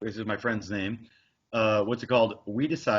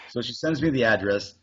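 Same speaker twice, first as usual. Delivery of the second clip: ordinary delivery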